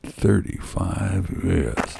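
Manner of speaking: low, slow, soft mutter; weary low grumble; muttered through gritted teeth. A narrator's reading. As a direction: drag it out through clenched teeth. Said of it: "through gritted teeth, he muttered".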